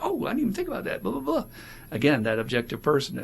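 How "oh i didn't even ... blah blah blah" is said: much higher put-on surprised voice; mock realization. Sponsee voiced realizing something to the sponsor, high mock surprise. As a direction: gasp it out high, half laughing. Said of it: "you'll be like".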